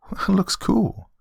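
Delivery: put-on high child voice full of wonder; little girl. Daughter noticing uncle's glowing eye, little-girl wonder. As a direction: marvel as a small girl.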